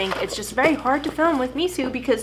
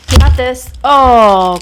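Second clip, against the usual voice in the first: excited; 12 dB louder, much slower than usual. Real, not acted. Excited owner shows dog toy.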